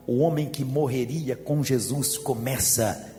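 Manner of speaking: normal talking voice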